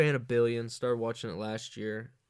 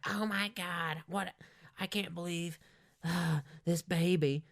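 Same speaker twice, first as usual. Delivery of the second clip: freaking-out lady; 7 semitones higher than usual. Retold or imitated. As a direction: play the shocked woman over the baby. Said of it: "She's like".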